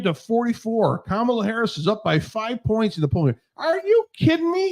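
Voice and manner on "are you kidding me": pitched way up in disbelief; can't believe what he's hearing; high, stunned are you kidding me; incredulous. Real, not acted. Incredulous at poll numbers.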